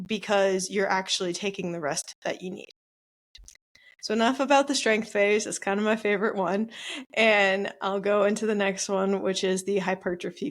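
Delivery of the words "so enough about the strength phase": normal talking voice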